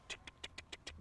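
unvoiced tsk-tsk clicking, disapproving; tongue clicks. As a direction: click the tongue like a scolding finger. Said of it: "Paul's like".

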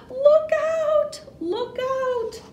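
high, loud storybook cry of alarm; play-acted high-pitched alarm; alarmed warning. A narrator's reading. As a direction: call out a warning, pitch shooting way up. Sleigh speeds downhill in story.

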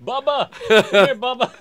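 very high and loud, laughing through it. Pretend. Host joining the joke calling the manager, loud laughing mock holler. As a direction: holler like a good old boy at a barbecue.

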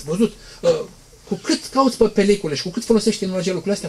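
ordinary delivery

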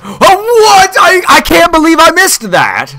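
very high cry of disbelief; high-pitched gamer's protest; incredulous outcry. Real, not acted. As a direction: wail in shocked frustration. Disbelief at missed shot.

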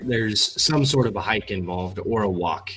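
normal talking voice

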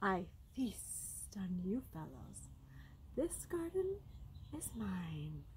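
soft, slow snake voice; quiet, sibilant menace; hissing snake. A narrator's reading. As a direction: draw out every S softly. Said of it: "hissed the snake from down under a pine".

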